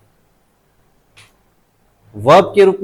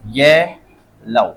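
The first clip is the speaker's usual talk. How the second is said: Yellow drawn out slowly, first syllable stressed; 3 semitones lower, much slower than usual. Shown as a demonstration. Teacher showing class the stress, yellow slowly split with "ye" stressed. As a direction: stretch yellow syllable by syllable, weight on "ye". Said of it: "stress कहाँ आ रहा है?".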